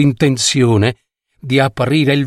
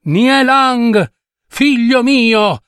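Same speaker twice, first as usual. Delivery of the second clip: high, slow, desperate call; crying out; 10 semitones higher, slower than usual. Acted. Mother Nie calling to her son, desperate cry. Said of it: "gridò madre Nie".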